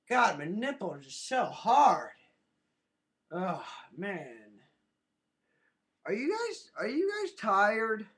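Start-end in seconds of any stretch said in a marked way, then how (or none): none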